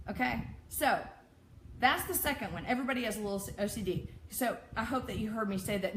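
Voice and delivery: usual speaking voice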